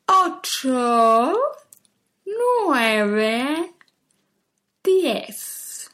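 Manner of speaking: each number chewed slowly like a cow eating grass. Shown as a demonstration. Teacher showing the class how to chew each number. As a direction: chew every word with exaggerated mouth. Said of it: "exagerando la gesticulación".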